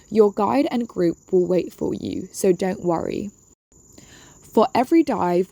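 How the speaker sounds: usual speaking voice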